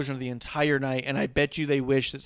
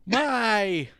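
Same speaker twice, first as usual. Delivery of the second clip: high, loud hammed-up gasp; mock shriek; 9 semitones higher, 9 dB louder than usual. Host imitating Excalibur reacting to the explosion, mock shriek. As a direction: overplay a horrified commentator. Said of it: "you just hear Excalibur go".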